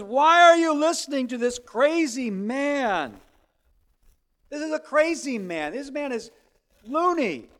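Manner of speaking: loud, scornful jeer at a crazy man; mocking crowd. Crowd jeering at Jesus, loud and scornful. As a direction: sneer it out to the crowd.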